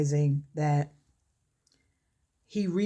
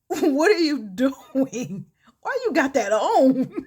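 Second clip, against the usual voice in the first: loud, high, stretched-out question through laughter; imitated cracking up; 9 semitones higher, 7 dB louder, slower than usual. Wife to husband in his too-small prom suit, mock laughing disbelief. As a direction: act out laughing too hard to ask it. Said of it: "Felicia is cracking up laughing".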